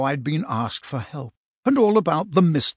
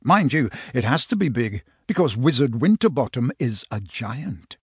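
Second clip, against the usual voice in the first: plain reading voice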